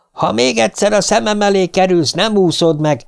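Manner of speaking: high-pitched threat, yelled only mildly; mock yell. A narrator's reading. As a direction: call an angry threat after them, volume held back. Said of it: "üvöltötte Gerton a távolodó pár után". (visszafogott, magas hangú üvöltés)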